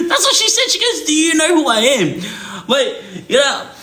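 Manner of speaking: high, squealing laugh through the words; can't hold back the laughter retelling it; cracking up mid-sentence; laughing while speaking. Real, not acted. Laughs retelling the lady's line.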